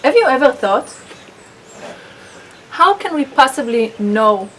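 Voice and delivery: ordinary delivery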